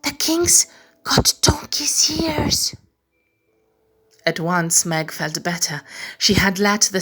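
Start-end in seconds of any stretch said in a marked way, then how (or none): 0.0-2.8 slow, high breathy whisper. Acted. Meg secretly telling the tree, slow high whisper. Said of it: "whispered to the trunk".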